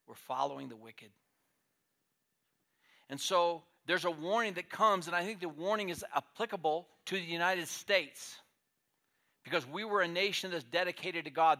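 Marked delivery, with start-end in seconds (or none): 3.1-11.6 raised, emphatic preaching; fervent sermon warning. Acted. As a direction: press the warning home from the pulpit.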